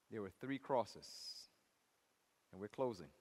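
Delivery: low, soft preaching with long pauses; subdued, weighty account of the cross; solemn hush. Real, not acted. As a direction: tell it reverently and quietly.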